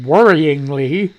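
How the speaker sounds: worryingly drawn out slowly, tasting the word; repeating the odd word worryingly slowly to himself; slow savoring of worryingly. Shown as a demonstration. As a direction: stretch out worryingly as if it's a strange word. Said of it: "that's a hell of a word".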